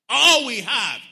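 very loud, high cry of complaint; complaining. Acted. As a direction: belt out the grievance to the crowd. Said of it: "They began to complain".

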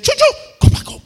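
high, loud call to a dog. Retold or imitated. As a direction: shoo the dog home. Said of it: "She said".

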